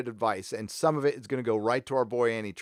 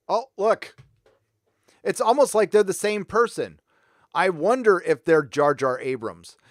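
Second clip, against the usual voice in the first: loud, higher-pitched feigned discovery; sarcastic mock surprise; 4 semitones higher, 7 dB louder than usual. Vlogger showing viewers two identical credit lists, loud mock surprise. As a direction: pretend to be amazed, loudly.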